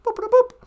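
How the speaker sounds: high, chirpy mouth-made boops; computer beeps. Pretend. Playing holodeck, imitating computer beeps. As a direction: beep like a sci-fi computer.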